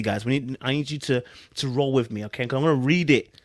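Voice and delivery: ordinary delivery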